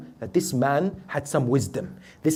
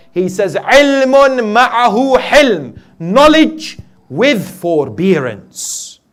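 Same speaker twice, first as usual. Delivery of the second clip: loud, raised, declamatory sermon voice; full-force preaching; 8 semitones higher, 12 dB louder than usual. Real, not acted. Preacher proclaims the Bedouin's answer about knowledge, full-force preaching. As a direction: proclaim question and answer to the whole hall.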